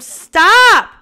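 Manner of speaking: high, loud scream of alarm. A narrator's reading. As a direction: scream to stop the attack. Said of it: "Kimura screamed".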